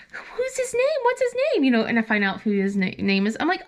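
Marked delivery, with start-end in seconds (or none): 0.1-1.6 eager, much higher questions; eager mom. Retold or imitated. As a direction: pounce on the name with glee. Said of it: "And I'm like".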